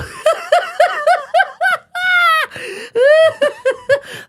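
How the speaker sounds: long high cackling laugh; a signature screaming cackle. Retold or imitated. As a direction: cackle big and loud. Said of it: "She'd go".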